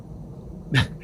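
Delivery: hushed amazed chuckle; a quiet stunned laugh; whispered laugh of wonder; soft breathy laugh. Real, not acted. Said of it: "awed reaction".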